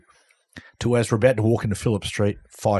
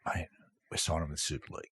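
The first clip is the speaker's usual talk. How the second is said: soft, hushed aside; hushed secret to a teammate; retold whisper; 6 semitones lower, 14 dB quieter, faster than usual. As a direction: lean in and say it under your breath. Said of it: "whispered to him".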